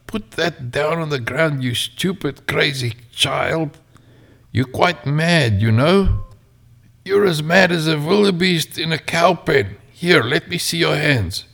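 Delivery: put-on high, scolding old man. Old carver berating the child, high and scolding. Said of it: "he shouted".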